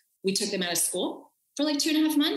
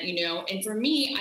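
ordinary talk, nothing marked

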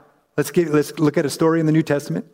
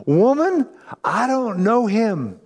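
higher, strained voice blurting out the denial; panicked Peter denial; 5 semitones higher, slower than usual. Acted. Peter to the servant girl, panicky high denial. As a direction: play Peter scared and backing off.

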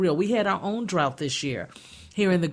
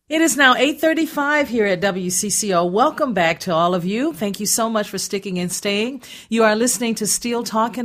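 louder, bright on-air welcome back; radio host comeback; 3 semitones higher, 5 dB louder than usual. Real, not acted. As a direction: announce the station time like a radio host. Host returns from break on air.

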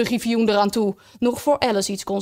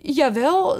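higher, uncertain little reply; hesitant; 5 semitones higher than usual. A narrator's reading. Alice answering the griffin's challenge, hesitant. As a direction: hesitate over admitting it. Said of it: "zei Alice aarzelend".

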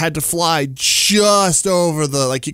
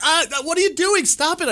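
high, alarmed protest; startled and annoyed; 14 semitones higher than usual. Retold or imitated. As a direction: yelp it out, startled. Reacting to drone in dream.

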